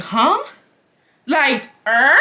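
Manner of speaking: high rising questioning noises; hammed-up confused double take; baffled. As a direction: grunt the question upward in disbelief. Acts out baffled reaction.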